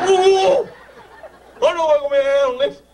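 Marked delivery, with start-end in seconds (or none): 0.1-0.7 high, loud laughing ooh; delighted ooh. Real, not acted. Emcee ogling the volunteer on stage, laughing ooh. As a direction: let out a gleeful ooh.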